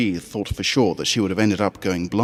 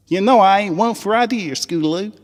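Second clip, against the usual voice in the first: much higher, Southern twang, eager; high country filly voice; 12 semitones higher, 4 dB louder than usual. Acted. Apple Bloom rallying the Crusaders on the school trip, high Southern twang.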